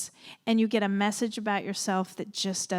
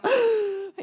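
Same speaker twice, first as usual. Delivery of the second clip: sharp, high, loud gasp; mock-shocked gasp; 11 semitones higher, 7 dB louder than usual. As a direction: gasp as if you just heard a dark secret. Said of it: "and I go".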